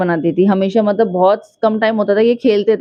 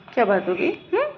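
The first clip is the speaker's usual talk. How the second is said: ordinary talk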